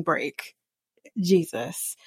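a whispered exasperated sigh of a word. Real, not acted. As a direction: breathe it out under the breath, fed up. Exasperated aside after rant.

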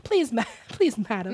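high, hammed-up English accent; mock English pleading. Correcting sir to madam, giggly mock English plea. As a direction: beg a madam in fake English. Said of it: "I'm gonna just sound like Oliver".

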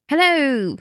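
high, put-on hello; alternative voiceover. Podcaster suggesting a different voiceover style, high put-on voice. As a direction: sing out a high greeting. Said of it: "Why can't we be like".

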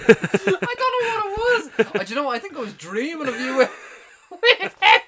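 squealing with laughter, high; can barely talk for laughing; laughing hysterically. Real, not acted. Laughing fit while talking.

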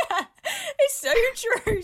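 high laughing squeal. Real, not acted.

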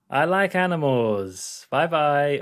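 slow, drawn-out mock sing-song; slow, goofy put-on farewell; imitated awkward sign-off. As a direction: imitate someone clumsily covering up a phone call. Imitates scientist faking his way off call.

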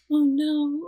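high, loud "oh no" in mock dismay. Two friends realizing it together, mock dismay.

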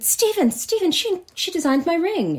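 high excited call to her husband; imitating an excited wife. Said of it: "She got very excited".

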